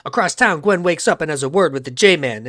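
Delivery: usual speaking voice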